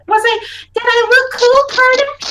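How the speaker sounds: put-on high, loud kid fishing for approval; eager child. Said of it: "She's asking as she's, like, squinting".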